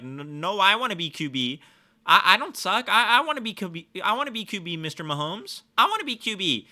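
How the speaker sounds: put-on high, loud whiny kid begging to be QB; whiny pleading. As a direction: plead like a little kid who wants the ball. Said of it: "I can't just be like".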